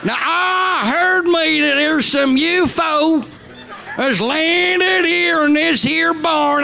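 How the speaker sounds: high, drawling country-bumpkin bluster. Acted. Mimics hick farmer as film hero.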